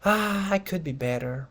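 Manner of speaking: sighing, resigned answer; slightly pessimistic. Shown as a demonstration. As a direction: open on a weary "Ah" and let it droop. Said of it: "una entonación un poco pesimista".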